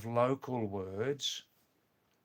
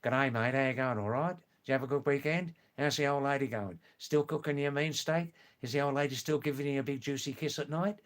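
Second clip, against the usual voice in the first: fast, slurred broad Australian mateship chatter; broad Australian accent; faster than usual. Shown as a demonstration. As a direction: rattle it off like a broad Aussie bloke at work on Monday. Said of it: "fifty percent of Australians talk like this".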